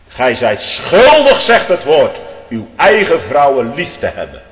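loud, high, slow "Gij zijt schuldig"; thundering scripture. Acted. Preacher declaring husbands' duty, loud and high. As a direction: proclaim the verse from the pulpit.

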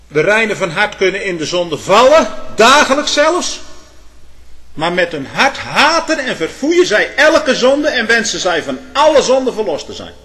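loud, high-pitched preaching fervour; krachtige preek. Real, not acted. Preacher raises his voice. (vurig en luid gepreekt)

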